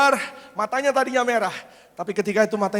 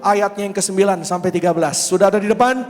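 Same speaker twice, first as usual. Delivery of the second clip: preaching at full force; 7 dB louder than usual. Real, not acted. Pastor has the church stand and read Psalm 91 aloud. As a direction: lead the church through the psalm. Leads declaration and scripture.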